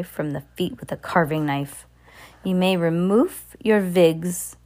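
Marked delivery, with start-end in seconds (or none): 2.4-4.6 witch's command. Acted. Grand High Witch commanding.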